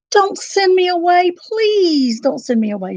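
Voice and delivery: high, loud pleading; begging. A narrator's reading. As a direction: beg them not to send her off. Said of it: "she begged".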